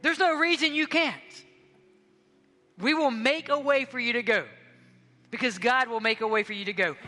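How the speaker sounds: rally the congregation to go; preaching at full force. Real, not acted. A pastor urging the church to go on missions, forcefully.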